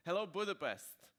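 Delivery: usual speaking voice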